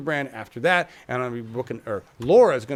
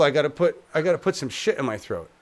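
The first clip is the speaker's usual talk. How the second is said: ordinary delivery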